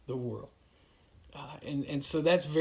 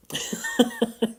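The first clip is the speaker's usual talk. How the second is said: short, higher-pitched laugh; 9 semitones higher, 5 dB louder than usual. Real, not acted. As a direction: burst out in a brief chuckle. Laughs after puzzling quote.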